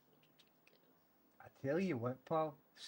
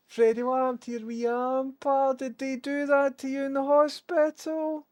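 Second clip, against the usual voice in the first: higher, louder cooing pet voice; 10 semitones higher, 6 dB louder than usual. Real, not acted. Owner fusses over the dog back from hospital in a high cooing pet voice. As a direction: coo at the poorly dog, high and fond.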